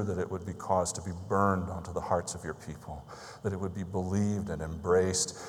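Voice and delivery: pastor's hushed closing prayer. Acted. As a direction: pray over the congregation quietly.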